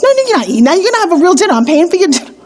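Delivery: hammed-up father overruling his daughter; insistent dad.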